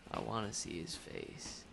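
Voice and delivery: ordinary delivery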